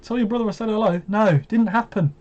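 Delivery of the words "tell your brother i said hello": mock cat greeting. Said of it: "Your cat didn't go".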